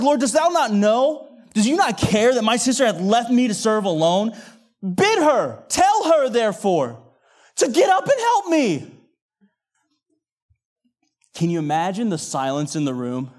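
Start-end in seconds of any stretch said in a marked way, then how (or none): 0.0-8.9 loud, high outburst climbing to a holler; upset yell. Acted. Martha confronting the Lord about her sister, yelling. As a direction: cry out in exasperation, punching each demand. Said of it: "When Martha yells".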